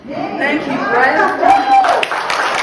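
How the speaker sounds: host hyping up the guest's entrance; big welcome call. Real, not acted. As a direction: sing out the name to bring her on stage. Introducing the guest comedian.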